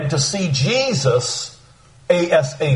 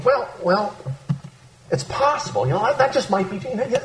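hesitant; 5 semitones higher, 4 dB quieter than usual. Retold or imitated. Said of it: "no uncertainty in John's voice".

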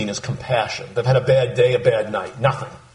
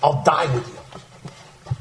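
high, hammed-up eager vow; overeager Peter; 11 semitones higher than usual. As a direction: gush your loyalty like brash Peter. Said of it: "Peter stuck his foot in his mouth".

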